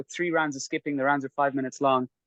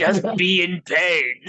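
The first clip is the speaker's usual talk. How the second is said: drawn out slowly, higher and louder; slow mock mantra; 5 semitones higher, 5 dB louder, much slower than usual. Quoting the coach's mantra to friends, slow and drawn out. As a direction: stretch each word like a calm guru. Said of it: "Rick Jackson says".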